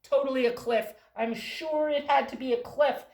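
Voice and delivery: loud dismissive insistence; loud certain mouse; arguing mouse. Acted. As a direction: brush them off loudly. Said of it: "purple mouse that came up and said".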